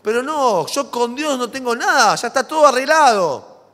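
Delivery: a loud, high, dismissive imitation; mocking smug denial. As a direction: parody the people who insist all is fixed. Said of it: "y dicen".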